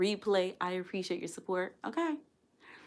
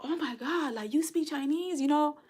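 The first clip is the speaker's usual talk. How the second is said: imitating amazed diners; 9 semitones higher than usual. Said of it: "And they're like".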